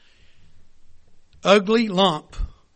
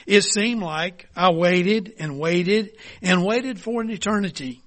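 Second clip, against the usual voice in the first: ordinary talk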